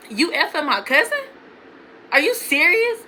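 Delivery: retold indignant questioning, rising at the end; incredulous confrontation. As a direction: confront him in mock disbelief. Retold confrontation with cheating boyfriend.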